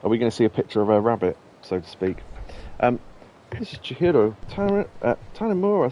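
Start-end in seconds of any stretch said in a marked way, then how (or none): 3.5-5.9 grateful hostess. Acted. Reads Chihiro's message aloud.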